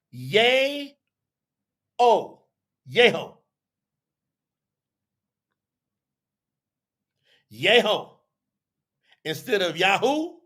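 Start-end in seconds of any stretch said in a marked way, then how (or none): 0.1-1.0 Yay-O in two long syllables, YAY and OH; drawn-out pronunciation. Shown as a demonstration. Teacher showing what the vowel points make, drawn-out Yay-O. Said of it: "they're saying that that makes that". 2.9-8.1 Yeho said as YAY-ho; shown pronunciation. Shown as a demonstration. Teacher repeating what the vowel points make, Yeho as YAY-ho. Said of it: "they're saying that that makes that".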